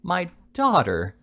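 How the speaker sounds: high, slow "My daughter!" in disbelief; shocked cry. A narrator's reading. The old lady hears her daughter was kissed, shocked cry. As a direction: let the shock pull it up and out.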